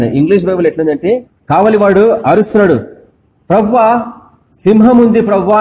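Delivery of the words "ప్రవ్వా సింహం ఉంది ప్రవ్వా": higher mock cry of alarm; watchman crying out. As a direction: raise the alarm from the tower. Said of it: "అని అరుస్తున్నాడు". (కావలివాడి హెచ్చరిక కేక)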